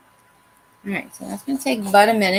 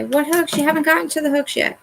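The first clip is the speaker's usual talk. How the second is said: higher, quicker mock viewer voice; imagined viewer butting in; 8 semitones higher than usual. Viewer interrupts crafter about missing hooks, mock questioning. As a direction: put on a pestering viewer, pitched up. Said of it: "You say".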